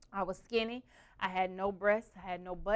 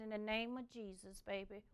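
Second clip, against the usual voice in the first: soft prayer; 10 dB quieter than usual. Retold or imitated. Grandma praying softly.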